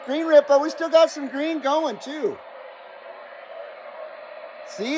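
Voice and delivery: usual speaking voice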